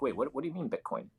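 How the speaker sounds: ordinary talk, nothing marked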